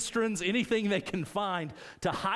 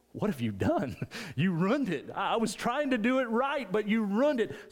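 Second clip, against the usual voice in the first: put-on mom's scold turns to a higher kid whine; scold, then protest like the hurt kid; 7 semitones higher than usual. As a kid, his mom scolds him, then he protests, higher. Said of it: "and she comes in".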